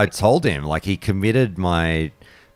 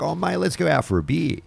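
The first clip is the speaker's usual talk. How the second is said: higher, friendly mock matey offer; chummy mate; 11 semitones higher than usual. Mocking his own quick turn to friendship, chummy and higher. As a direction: play the easygoing Aussie inviting his old enemy. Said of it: "I'm like".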